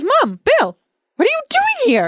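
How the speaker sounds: high, stunned question; playing a shocked Ron. Ron, stunned at seeing his mom and brother. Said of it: "Ron looks around stunned and he goes".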